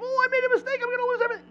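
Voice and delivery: very high, squeaky fretting; exaggerated high-pitched worry; mock panic. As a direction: whine it like a panicked worrier. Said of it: "you think".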